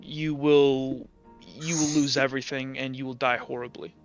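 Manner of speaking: ordinary delivery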